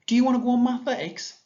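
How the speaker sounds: put-on high, fast, lively child's question; voicing Esther. Shown as a demonstration. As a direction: read it as an eager big sister asking.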